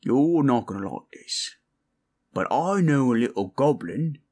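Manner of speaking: usual speaking voice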